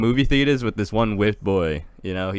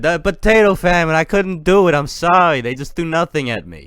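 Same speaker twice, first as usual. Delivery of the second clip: put-on higher, louder Italian-accented pleading; 6 semitones higher, 5 dB louder than usual. Hungry peasant explaining failing to bring dinner, hammed-up Italian accent. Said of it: "That was an Italian accent".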